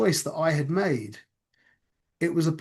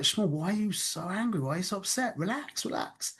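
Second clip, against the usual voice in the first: soft, higher-pitched retold calming; soothing friend; 3 semitones higher, 8 dB quieter than usual. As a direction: play the friend gently talking someone down.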